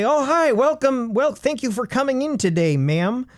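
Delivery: high, chirpy greeting; eager salesman. Retold or imitated. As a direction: play the overeager shop clerk. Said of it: "They're expecting you to come up and say".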